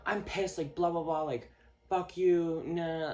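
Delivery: put-on huffy rant; imitated anger. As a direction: mimic someone pissed off, drawing out the insult. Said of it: "when you're mad at me and you're like".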